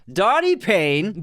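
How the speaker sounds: high, slow hyped-up announcement; shout-out to a patron, slow and hyped; hype announcement. Acted. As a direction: announce him like a hype man.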